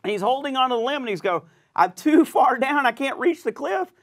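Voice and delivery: high, loud panicked pleading; terrified cry for help. Retold or imitated. Man hanging from a cliff limb begs God, high panicked cries. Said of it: "he's scared to death".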